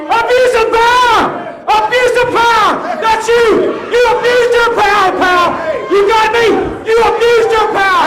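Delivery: screaming in outrage, very high and loud; furious shouting. Acted. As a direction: yell it at him at full force. Furious referee yells at champion.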